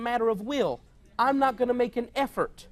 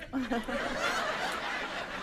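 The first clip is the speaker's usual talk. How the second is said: high, soft burst of laughter; real laughter, high and soft; 8 dB quieter than usual. Real, not acted. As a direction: crack up at the punchline. Laughing at his own joke.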